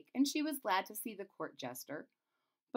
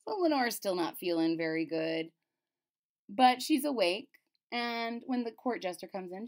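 plain reading voice

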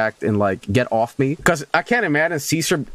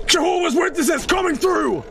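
high, shouted preacher voice calling through the door; hammed-up Jehovah's Witness at the door; mock door-to-door preacher; 13 semitones higher than usual. Mock evangelist at stuck door.

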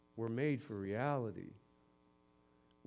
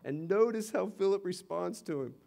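ordinary delivery

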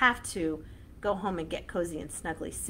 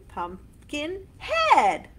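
loud slow call; 7 semitones higher, 7 dB louder, much slower than usual. Shown as a demonstration. Chant with punched endings.

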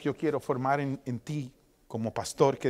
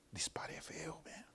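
whisper; 20 dB quieter than usual. Retold or imitated. Talking to himself in mirror.

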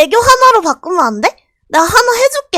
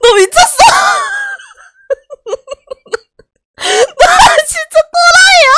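높고 크게, 울먹이듯 웃으며 외친다; 어이없어 터지는 소리; 11 semitones higher, 5 dB louder than usual. Real, not acted. (웃다 우는 듯한 고함)

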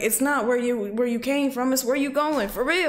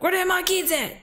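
higher, frantic imitation of a worried parent; playful mock panic; mock panicked parent; 9 semitones higher than usual. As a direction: act out a sudden panic over missing kids. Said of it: "I would low-key panic".

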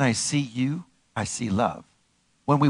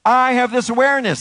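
high, loud proclamation; raised, declarative preaching voice; rallying affirmation; 9 semitones higher, 6 dB louder than usual. Shown as a demonstration. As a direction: call it out for them to repeat. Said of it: "Say it with me".

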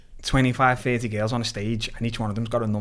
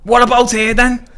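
much higher, louder, laddish tease; mates asking; 11 semitones higher, 10 dB louder than usual. Retold or imitated. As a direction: nudge him about the girl, loud and high. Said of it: "And I'm like".

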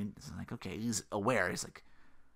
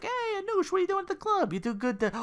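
high mock voice. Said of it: "Cousin Louie's like".

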